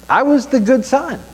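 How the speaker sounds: proud, high self-praise; mock boastful. Real, not acted. Boasting ironically about being perfect.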